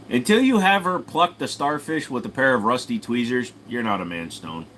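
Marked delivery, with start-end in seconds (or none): none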